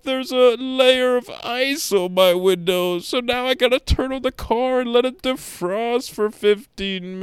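high, loud, nasal-free whining about snow; whiny complainer voice. Shown as a demonstration. Comic demo of his complaining voice, high and whining. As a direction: whine like a helpless snow victim. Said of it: "This is my complaining voice".

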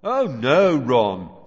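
loud, mocking false reassurance; very sarcastic. A narrator's reading. Fred answering his brother, loudly sarcastic. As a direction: lay the sarcasm on thick and loud. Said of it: "very sarcastically".

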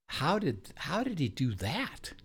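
high, slow marveling question; acted amazement. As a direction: imitate a group struck with wonder. Acts out the group's amazement.

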